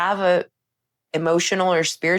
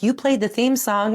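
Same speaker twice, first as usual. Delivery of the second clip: higher, teasing accusation; playful protest; 7 semitones higher than usual. Retold or imitated. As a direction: call them out with a grin. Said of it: "And I was like".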